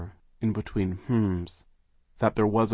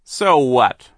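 dismissive; 6 semitones higher, 14 dB louder than usual. A narrator's reading. Villain shrugs off accusation.

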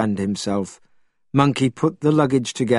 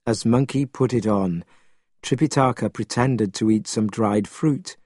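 ordinary delivery, nothing marked

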